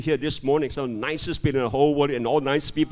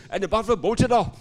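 sudden higher-pitched punchline; animated storytelling; 7 semitones higher, 4 dB louder than usual. A narrator's reading. Pastor telling how the buffalo ran, animated storytelling. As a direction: jump up in pitch for the getaway.

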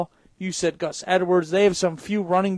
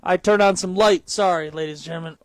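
ordinary talk, nothing marked

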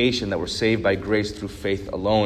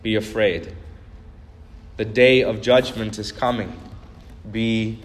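preaching the world's fear. Acted. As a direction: intone each warning, then drop onto "be afraid".

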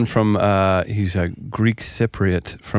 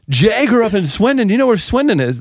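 eager introduction; 11 semitones higher, 3 dB louder than usual. Retold or imitated. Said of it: "I was like".

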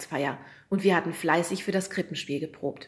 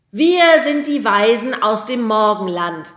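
slow, loud solemn announcement; announcing; 5 semitones higher, 12 dB louder than usual. A narrator's reading. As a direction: declare it slowly to the audience. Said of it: "verkündete jetzt Timo".

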